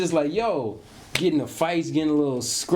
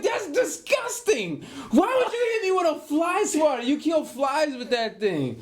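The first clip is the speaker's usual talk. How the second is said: retold childhood outrage, pitched way up; indignant kid protest; 11 semitones higher than usual. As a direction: squeal it like a wronged child. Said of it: "I'm like".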